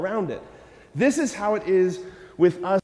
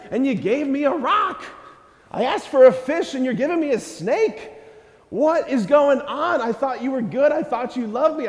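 mock exasperated complaint; 6 semitones higher than usual. Believer complaining to God about unanswered prayer, indignant whine. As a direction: play the betrayed kid griping at God. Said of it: "You're like".

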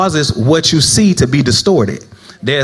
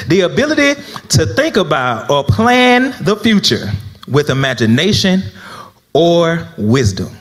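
dictation pace; 3 semitones higher than usual. Real, not acted. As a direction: dictate it piece by piece for note-takers. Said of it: "the saints still writing. One more again".

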